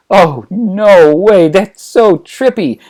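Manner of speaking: high, loud, drawn-out amazement; amazed. Acted. Pupil stunned by his master's revelation, slow loud amazement. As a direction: stretch it out slowly, mind blown. Luke reacts to shock revelation.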